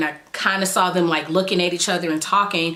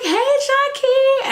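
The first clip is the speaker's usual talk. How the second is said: much higher, louder sing-song hello; cheery greeting; 4 dB louder, slower than usual. Retold or imitated. Old OB greeting her patient in the operating room, cheery sing-song.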